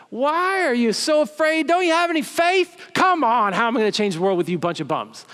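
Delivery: high, loud exasperated rebuke; angry Jesus. Retold or imitated. Jesus scolding his fearful disciples, a high loud angry rebuke. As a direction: scold the disciples like a fed-up boss. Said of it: "read in a tone that made Jesus sound like he was angry".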